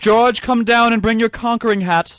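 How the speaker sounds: high, loud comic summons; mock shout to someone elsewhere; calling out. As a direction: holler for someone to come down, drawn out. Riffing as alien conquerors, summoning George.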